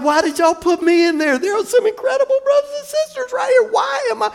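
high, pleading squeal of disbelief; exasperated protest. Retold or imitated. Retells his protest at newspaper.

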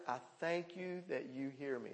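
very soft prayer of thanks; whisper-soft confident thanks; hushed prayer. Retold or imitated. Preacher modelling prayer to the congregation, very soft.

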